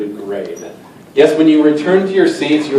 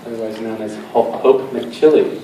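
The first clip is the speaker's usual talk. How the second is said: ordinary delivery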